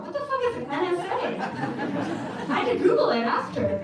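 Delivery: giggling, baffled outburst; laughing disbelief. Real, not acted. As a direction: laugh at how clueless you were. Laughing at her own confusion.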